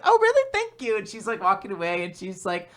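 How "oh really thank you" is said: delighted surprise. Acted.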